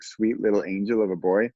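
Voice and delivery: normal talking voice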